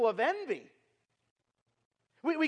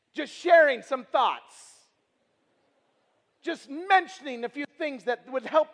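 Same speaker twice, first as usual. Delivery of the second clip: sarcastic, slow and loud; 3 semitones higher, 6 dB louder than usual. Acted. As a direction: drag it out with mock innocence. Ironic understatement about arguing.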